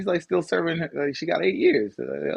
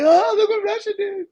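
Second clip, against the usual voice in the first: very high whiny mock tattling; whiny tattletale. Mocking the US telling the world, very high whiny tattling. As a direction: whine it like a kid telling on someone.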